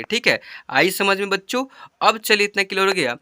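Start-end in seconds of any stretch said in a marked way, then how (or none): none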